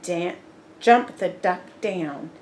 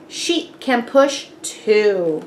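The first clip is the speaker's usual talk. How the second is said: slow croak; 6 dB louder than usual. A narrator's reading. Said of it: "croaks Frog".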